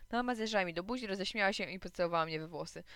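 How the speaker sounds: ordinary delivery, nothing marked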